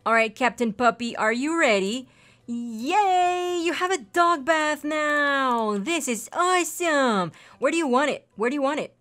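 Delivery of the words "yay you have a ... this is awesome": high, drawn-out gushing to a pet; slow sing-song excitement; excited cheer. Real, not acted. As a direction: celebrate a big present for the dog. Celebrating the new dog bath.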